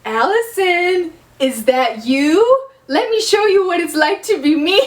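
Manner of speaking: hammed-up villain stretching every word; mock-menacing Jenna. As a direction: tease them slowly, theatrically creepy. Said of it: "Jenna starts saying something like".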